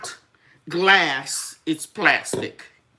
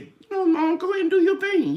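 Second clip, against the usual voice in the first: lightly voiced daughter, much higher; a higher, encouraging hint of the daughter; mother quoting her daughter's pep talk in a higher voice; voicing her daughter; 13 semitones higher, 3 dB louder than usual.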